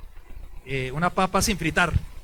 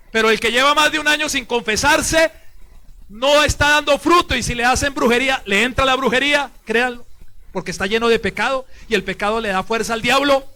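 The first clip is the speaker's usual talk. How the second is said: louder, higher warning from the pulpit; forceful preaching; 5 semitones higher, 8 dB louder than usual. Real, not acted. Priest warning the congregation about unconfessed sin, forceful preaching.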